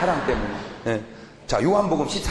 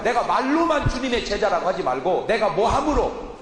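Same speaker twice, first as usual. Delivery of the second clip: ordinary talk, nothing marked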